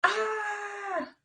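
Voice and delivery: high, loud shriek; roller coaster scream. Acted. Sam screaming on the roller coaster. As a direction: scream on the drop.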